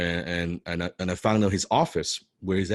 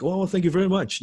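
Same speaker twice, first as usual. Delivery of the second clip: high-pitched, taken-aback thanks; a bit shocked; 11 semitones higher, 3 dB louder than usual. Retold or imitated. Stranger thanking an overexcited fan, high startled politeness. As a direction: imitate someone startled by praise. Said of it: "first he was a bit shocked".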